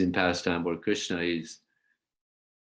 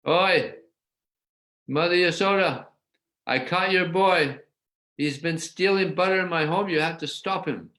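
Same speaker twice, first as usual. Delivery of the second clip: put-on an elderly gopi hollering for Mother Yasoda, voice raised high; calling out; 9 semitones higher than usual. Gopi calling Yasoda at her door, raised high voice.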